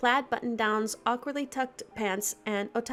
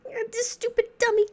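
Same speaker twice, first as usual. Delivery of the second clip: put-on high crying girl voice; 10 semitones higher, 5 dB louder than usual. Vanilla wails in your arms.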